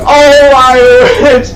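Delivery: ordinary talk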